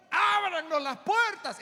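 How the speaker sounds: high, loud, drawn-out demand; calling out. Acted. Locked-out virgins calling at the door, loud urgent cry. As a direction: shout to be let in.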